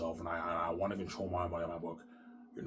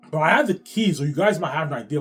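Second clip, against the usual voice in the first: sudden shout; 7 semitones higher, 13 dB louder than usual. Real, not acted. Sudden loud outburst.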